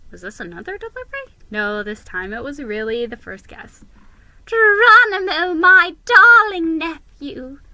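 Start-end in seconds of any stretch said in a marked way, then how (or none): 4.5-7.6 high, loud, slow doting squeal; squeaking aunt. Acted. Favorite aunt greeting her nephew, high and loud. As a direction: squeal it with delight. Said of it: "squeaked my Aunt Sweetfur".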